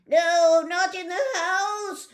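high, loud, fussy fish; upset fish voice. Acted. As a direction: play a panicky little fish, loud and high. Fish crying out.

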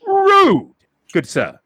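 a high, loud outraged Rude, then prim; indignant gentleman. Acted. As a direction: huff it like an offended gentleman. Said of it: "He's like".

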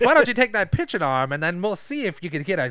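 Jonathan Lovett impression. Scout offering a pitcher a tryout, in Jonathan Lovett impression. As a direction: proposition her as a cocky talent scout. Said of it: "You do his voice really good".

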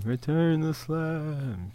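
very soft, slow echo. Acted. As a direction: breathe the words back quietly. Echoing catchphrase, very soft.

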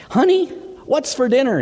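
high-pitched call to a wife in another room. Acted. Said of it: "he calls".

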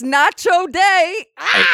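loud high yell, ending in a scream; shouting. Acted. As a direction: shout it with a sudden shriek. Shouting prank reveal.

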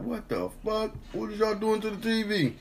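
high-pitched indignant whine; high, baffled self-defense; indignant protest. Acted. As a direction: protest innocence in a high squeaky voice. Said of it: "I'll be like".

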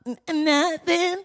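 put-on high, slow, timid kid denying it; mumbling kid caught out. Kid denying mumbling to a parent, high and hesitant. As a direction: play the caught kid stalling innocently. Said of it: "You like".

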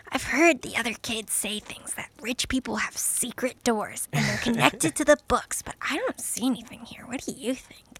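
hushed, breathy aside; conspiratorial whisper. Acted. Finny confiding in Wallace about secret doors, whispering.

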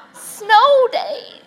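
high loud laughing wail; hammed-up laughing lament; mock wail laughing. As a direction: moan it through a laugh. Mock despair.

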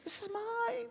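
high, strained clinging voice; possessive selfish whine. Acted. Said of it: "you tighten your fist like".

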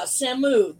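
normal talking voice

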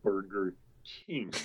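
slow, low and soft mimicry; mock deadpan. Friends teasing each other over dinner, slow mock deadpan. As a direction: echo the punchline slowly and quietly. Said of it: "hold something up and go".

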